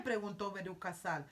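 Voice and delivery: ordinary delivery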